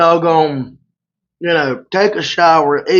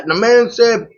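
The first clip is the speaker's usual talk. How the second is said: ordinary talk, nothing marked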